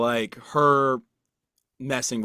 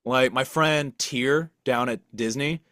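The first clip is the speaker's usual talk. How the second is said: ordinary talk, nothing marked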